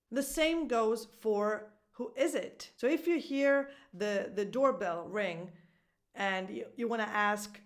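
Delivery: ordinary delivery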